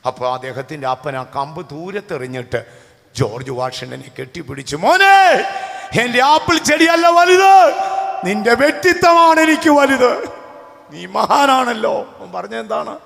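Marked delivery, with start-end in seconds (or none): 4.8-11.9 high, loud, proud father's cry that slows at the end; a father bursting with pride, calling out to his son; joyful shout. Retold or imitated. Father embracing his son after the confession, proud loud shout.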